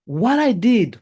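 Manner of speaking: ordinary delivery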